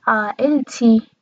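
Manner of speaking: عائلتی as aa-il-ti, no vowel after l; common Arabic pronunciation. Shown as a demonstration. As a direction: close on the l before ti in عائلتی. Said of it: "عموم کشورهای عربی دو سیلابی تلفظ".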